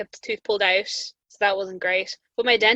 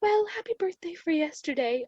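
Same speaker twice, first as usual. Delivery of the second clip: higher, sweet dentist voice; imitating the dentist; 8 semitones higher, 4 dB quieter than usual. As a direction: kindly wish the patient well. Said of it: "she was like".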